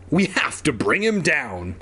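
usual speaking voice

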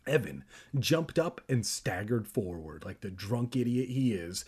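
ordinary delivery, nothing marked

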